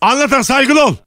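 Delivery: high, forceful demand for respect; stern. Acted. Ex-wife defending new partner to returned husband, stern and high. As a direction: cut in with a hammed-up stern order. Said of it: "Sen de bu kadar sert olamazsın".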